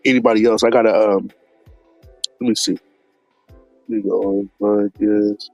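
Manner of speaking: ordinary delivery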